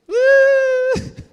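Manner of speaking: high, loud drawn-out squeal; exaggerated loud whine; mock wail. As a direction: let out a comic, sustained high cry. Acting out her reaction to failing.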